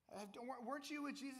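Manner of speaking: put-on a small girl who has spotted him; little girl voice. Second servant girl asks Peter in a high little-girl voice.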